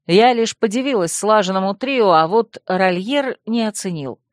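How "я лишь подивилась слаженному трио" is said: plain reading voice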